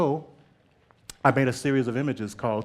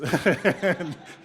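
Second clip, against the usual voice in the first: bright burst of real laughter, higher; 7 semitones higher, 5 dB louder than usual. Real, not acted. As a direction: laugh out loud at the memory. Laughing at her own story.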